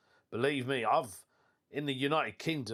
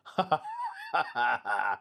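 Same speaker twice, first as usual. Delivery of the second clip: laugh. Real, not acted. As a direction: burst of amused laughter. Laughs at listener's post.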